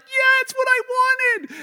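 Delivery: very high, loud, slow squeal of delight; thrilled child. Retold or imitated. Child opening a Christmas gift, squealing with delight. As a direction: squeal like a kid tearing open the perfect gift.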